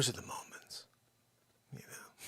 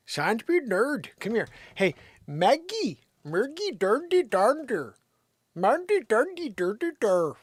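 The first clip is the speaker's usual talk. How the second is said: much higher, slow gibberish calling; mock Saint Bernard language; 10 semitones higher, 5 dB louder than usual. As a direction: call the dog in made-up foreign babble. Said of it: "speak to her in her native language".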